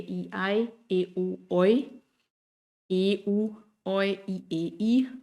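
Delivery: ai and oi said as clear glides; German diphthongs. Shown as a demonstration. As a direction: sound out each diphthong. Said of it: "Los diptongos".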